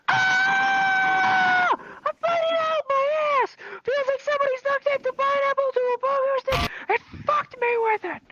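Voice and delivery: very high, drawn-out mock scream. Kid carried down the hallway, screaming in hammed-up agony. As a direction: wail it like you're dying. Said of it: "I started screaming even louder".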